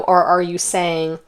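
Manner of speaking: ordinary talk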